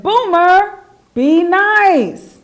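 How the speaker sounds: high, loud telling-off; scolding shout. Acted. Charlie scolds Boomer for freeing his crickets, loud and high. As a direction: yell it at the bully.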